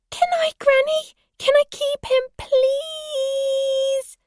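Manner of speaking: put-on very high, whiny child's begging; pleading little girl. A narrator's reading. Granddaughter begging Granny to keep rabbit, high pleading whine. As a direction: beg like a small child, stretching the plea. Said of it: "Eliza appealed".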